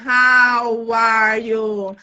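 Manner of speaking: "How are you?" said very slowly, each word separated; words kept apart. Shown as a demonstration. Teacher showing unlinked English words, very slowly. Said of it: "Você junta".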